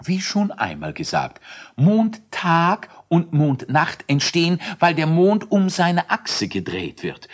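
high, earnest lecturing voice; scholarly lecture. Acted. Elisius lectures the friends most scientifically. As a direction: explain it like a pompous professor. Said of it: "Hochwissenschaftlich erklärte er".

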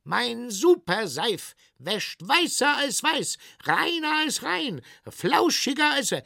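high-pitched sales patter; high, insistent advertising cry; boasting soap seller. Acted. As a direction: rattle off slogans in a high vendor voice. Soap seller boasts until cut off.

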